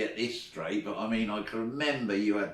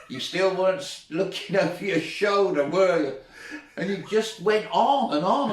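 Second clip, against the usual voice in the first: talking through laughter; high and loud, laughs breaking in; laughing; 7 semitones higher, 6 dB louder than usual. Real, not acted. Laughing while retelling.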